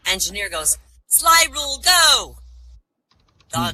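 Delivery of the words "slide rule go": loud, high-pitched starting order; shouted command. Acted. Engineer commanding his dog in the finals, shouted order. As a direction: call the dog into action loudly.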